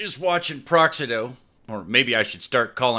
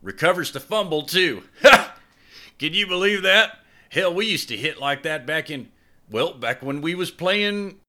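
gleeful then wistful; 11 semitones higher, 3 dB louder than usual. Acted. Boyfriend cheers brother's fumble recovery, laughing then wistful. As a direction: cheer the play then drift into memory.

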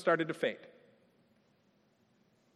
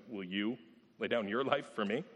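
quiet pointed question; 6 semitones lower, 6 dB quieter than usual. Retold or imitated. Jesus answering Peter quietly.

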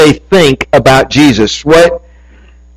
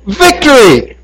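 yelling; 8 semitones higher than usual. Retold or imitated. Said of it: "And he was yelling".

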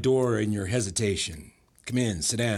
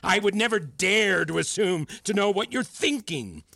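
worked-up tirade; 12 semitones higher, 3 dB louder than usual. Acted. Mentor accusing student of expecting mind-reading, agitated tirade. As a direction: voice pitched way up in agitation.

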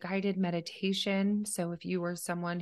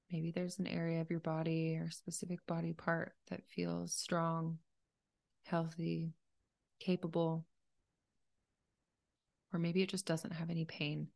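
softer, even meditation voice with long pauses; calm, unhurried meditation leader; guided meditation; 4 dB quieter than usual. Acted. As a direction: gently guide a listener through breathing, leaving space between phrases.

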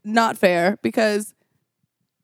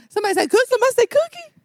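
hawk voice; 14 semitones higher, much faster than usual. Pretend. Showing her friend what hawks sound like, high squawk. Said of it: "That's what hawks sound like".

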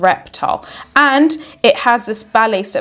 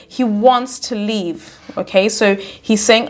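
ordinary talk

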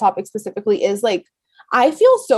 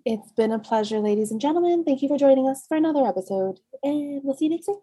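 flight attendant; 2 semitones higher, 6 dB quieter than usual. Acted. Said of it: "You sound like a flight attendant".

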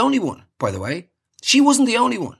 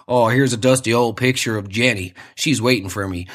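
low, wistful American soldier voice; war-movie soldier; 7 semitones lower than usual. Acted.

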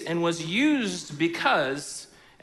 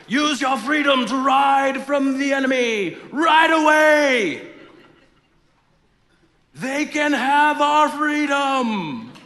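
shouted, much higher and louder; mock Wallace battle cry; 8 semitones higher, 8 dB louder, slower than usual. Pastor hamming William Wallace shouting to retreat. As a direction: yell it like a battlefield rally. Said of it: "William Wallace was like".

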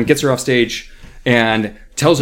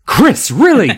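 high, loud reproach; indignant scolding; 11 semitones higher, 7 dB louder than usual. A narrator's reading. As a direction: scold in shocked disbelief. Teacher scolds the girl.

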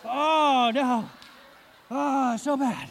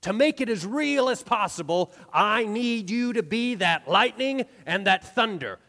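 ordinary talk, nothing marked

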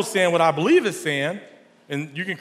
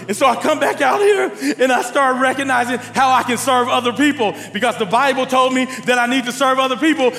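louder, higher preaching drive; full preaching; 6 semitones higher, 4 dB louder than usual. Acted. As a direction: push the call to serve at the crowd. Preaching to congregation.

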